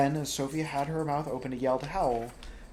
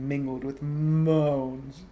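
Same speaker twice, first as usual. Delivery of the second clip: moaning; 5 dB louder, much slower than usual. Acted. Narration acts moans.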